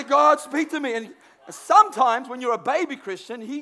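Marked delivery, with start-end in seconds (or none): 0.0-0.9 louder, insistent call to God; confident plea spoken out; earnest prayer, bolder. Retold or imitated. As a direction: demand an answer from God. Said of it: "And I say".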